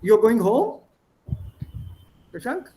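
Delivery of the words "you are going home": "You are going home?" with a sharp rise on "home"; questioning intonation. Shown as a demonstration. Teacher asking a student to show intonation, rising question. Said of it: "my intonation makes it a question".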